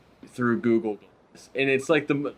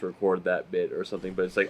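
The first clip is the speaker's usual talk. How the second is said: normal talking voice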